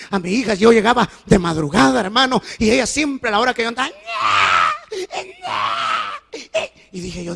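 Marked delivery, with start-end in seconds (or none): 3.9-6.7 mock child whimper; imitated whining cry. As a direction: mimic the daughter's whimper.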